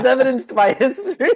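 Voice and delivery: high-pitched, laughing through the words. Real, not acted. Friend capping a joke with the punchline, laughing. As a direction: crack up while finishing the joke.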